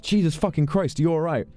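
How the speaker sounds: high-pitched imitation of shocked concern; alarmed reaction. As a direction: act out startled alarm. Acted reaction to a sudden fire.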